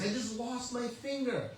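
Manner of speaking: a king wailing over his lost finger; retold cry of outrage; upset king. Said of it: "the king became very upset".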